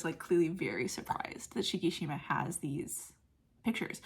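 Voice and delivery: normal talking voice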